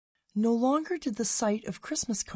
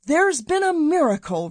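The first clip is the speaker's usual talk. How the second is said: louder and higher, each word pressed; emphatic; 9 semitones higher, 7 dB louder than usual. A narrator's reading. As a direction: insist on it firmly. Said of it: "repeated Emmett emphatically".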